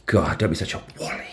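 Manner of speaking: hushed posh British tut; whispered posh British scold. Pretend. British toff scolds imaginary friend, whispered posh British accent. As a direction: whisper it like a prim British aunt. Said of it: "battered wally".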